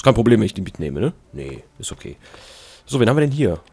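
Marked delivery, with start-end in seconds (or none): 1.3-2.2 mock reply in a hushed voice; whispered reply. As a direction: answer yourself under your breath. Answers his own question.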